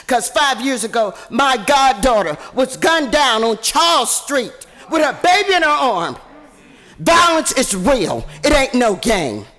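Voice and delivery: loud preaching at a council meeting; fiery preaching. Real, not acted. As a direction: preach it to the council, full force. Impassioned rally speech.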